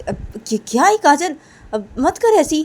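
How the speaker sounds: high, loud telling-off; scolding friend. Acted. Niti scolding Kajal, loud and high. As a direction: snap at Kajal to stop it.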